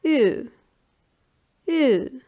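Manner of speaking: "Ü" as a rounded ee vowel; German umlaut sound. Shown as a demonstration. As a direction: say "Ü" as ee through rounded lips. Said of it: "is pronounced like".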